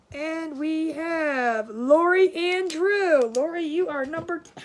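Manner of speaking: building game-show reveal; winner announcement. Real, not acted. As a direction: stretch out the name like an announcer. Announcing the name drawn.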